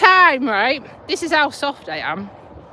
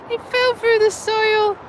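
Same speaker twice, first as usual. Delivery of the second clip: slow, high, drawn-out It fell through the soil; comic mock repeat; 8 semitones higher than usual. Shown as a demonstration. Retelling dad's fall for the viewers, slow high mock repeat. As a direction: savour the absurd line, stretching it.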